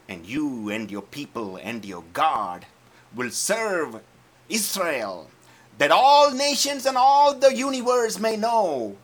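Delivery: high, loud preaching roar; David declaring God's might to the giant; thundering proclamation. Acted. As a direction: proclaim it like a battle cry.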